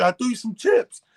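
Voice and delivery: high mocking voice of the friend. Mocking AJ's excuse.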